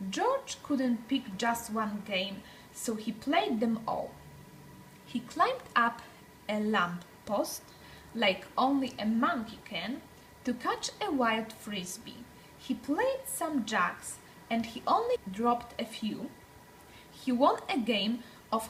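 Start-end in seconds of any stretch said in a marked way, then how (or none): none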